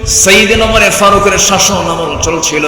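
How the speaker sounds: high, loud sermon storytelling; full-force preaching. Acted. Preacher opening a story of the caliph's reign, full-force preaching. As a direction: ring it out high and loud to a crowd.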